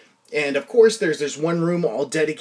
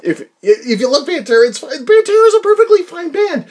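heated defensive rant; 9 semitones higher, 11 dB louder than usual. Real, not acted. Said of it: "I'm sorry".

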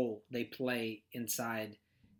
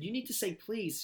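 teaching dad; 6 semitones higher than usual. Retold or imitated. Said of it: "and I said".

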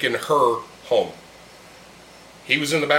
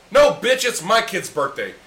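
sharp, higher "No, bitch" then dropping; scolding an imagined parent, mock angry; mock telling-off; 6 semitones higher than usual. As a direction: snap back at the pushy parent.

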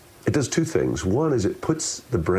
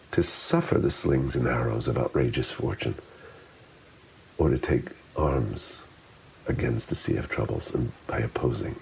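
living in the words; 6 semitones lower than usual. Shown as a demonstration. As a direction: take time over each phrase, pausing, fading toward a hush. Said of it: "living in the words".

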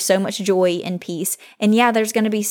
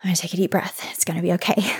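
whispered to herself, holding back tears; self-calming whisper; 4 dB quieter, much faster than usual. Real, not acted. Host steadying herself mid-thank-you to listeners, whispered softly. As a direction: breathe and whisper it to calm yourself. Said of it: "I'm gonna try not to cry".

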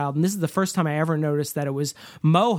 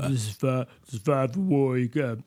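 low, mumbled Rambo voice; Stallone; 3 semitones lower, 4 dB quieter than usual. Acted. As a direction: try a soft, deep tough-guy mumble. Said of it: "You wanna do your Stallone?".

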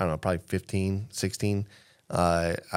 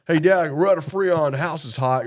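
high, louder mock kid; whiny son; 7 semitones higher, 6 dB louder than usual. Son complaining to his dad, whiny mock voice. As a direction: whine like a clueless teen.

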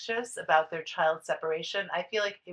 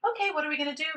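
put-on higher, bright sing-song to a baby; cheerful nanny to baby; 8 semitones higher, much faster than usual. Nanny turns to the baby after mom leaves, high sing-song. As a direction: perk the baby up with a question.

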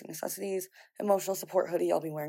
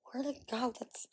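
whisper; 5 semitones higher, 7 dB quieter than usual. Real, not acted. Searching for lost ring.

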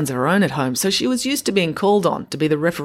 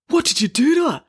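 high-pitched angry demand; 11 semitones higher, 4 dB louder than usual. A narrator's reading. Boy grabbing Tom by his collar, demanding. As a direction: demand an explanation, voice raised high. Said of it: "he demanded".

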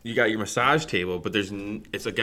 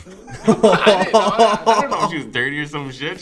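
laughing; 10 semitones higher, 10 dB louder than usual. Real, not acted. Cracking up at own story.

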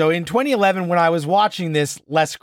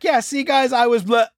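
breezy Peter; 7 semitones higher than usual. Retold or imitated. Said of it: "Peter's like".

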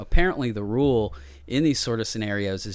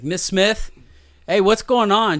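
raised, higher voice through a door; calling out; 9 semitones higher, 8 dB louder, slower than usual. Retold or imitated. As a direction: call to the teacher for answers. Retold calling through classroom door.

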